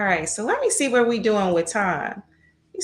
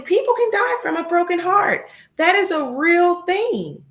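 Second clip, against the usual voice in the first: emphatic; 9 semitones higher, 3 dB louder than usual. Real, not acted. Emphatic about broken hearts.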